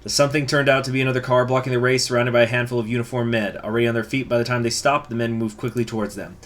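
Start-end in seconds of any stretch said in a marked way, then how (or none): none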